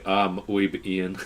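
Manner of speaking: slow, overdone gringo Spanish; bad Spanish accent. Coworkers joking in Spanish, in a bad gringo accent. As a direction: mangle the Spanish on purpose. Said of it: "worst way possible".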